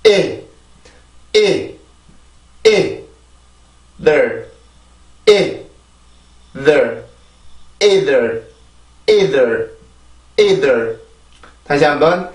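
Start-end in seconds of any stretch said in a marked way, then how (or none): 0.0-11.0 '이' and '들' drilled apart, then joined; vocal-cord onset drill. Shown as a demonstration. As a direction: launch '이' from the vocal cords, drop to '들'. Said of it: "성대만 사용을 합니다".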